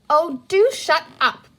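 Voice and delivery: high, loud exasperated snap. A narrator's reading. Big sister tells her brother to hush, exasperated. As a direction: cut him off, fed up.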